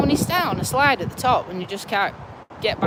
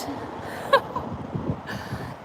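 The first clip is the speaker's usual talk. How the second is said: high breathy shaky laugh; relieved nervous laugh; 10 semitones higher, 12 dB quieter than usual. Real, not acted. After near fall.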